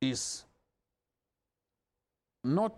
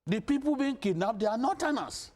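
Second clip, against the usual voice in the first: higher pitched, impassioned appeal; 5 semitones higher than usual. Real, not acted. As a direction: plead passionately with the northern leaders. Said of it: "I've never had to speak like this".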